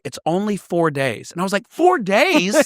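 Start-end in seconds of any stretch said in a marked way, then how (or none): 1.7-2.6 high-pitched incredulous echo; mock disbelief. Teen retelling shock at being sent away, incredulous. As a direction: throw it back as a stunned question. Retold shocked reply to parents.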